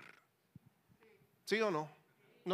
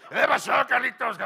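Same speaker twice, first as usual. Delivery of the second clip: booming, higher, burp-like greeting; Manuel; 6 semitones higher, 15 dB louder than usual. Retold or imitated. Friend repeating his greeting to Carlitos, loud burpy bellow. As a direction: blast it out like a belching big friend. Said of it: "parece que está eruptando".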